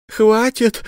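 пронзительный крик, намного выше; закричать в отчаянии. Acted. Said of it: "закричала вдруг его подруга". (подруга кричит следователю, высоко и громко)

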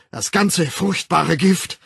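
loud, high, impassioned demand; urging. A narrator's reading. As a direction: urge it forcefully, stretching the end. Savage urges crowd to discard poison. (laut beschwörend)

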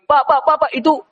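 high, eager calling out; calling brothers. Acted. Brothers call the trader over, high and eager. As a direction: flag the trader down, pitched high. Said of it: "dia panggil".

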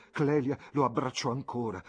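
ordinary delivery

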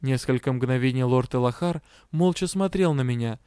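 usual speaking voice

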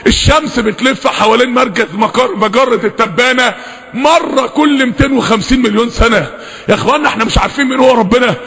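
ordinary delivery

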